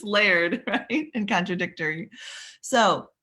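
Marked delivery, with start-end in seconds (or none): none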